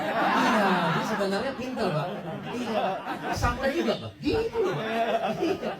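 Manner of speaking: high-pitched laughing through the words. Real, not acted. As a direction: laugh proudly while you talk. Proud laughing about clever daughter.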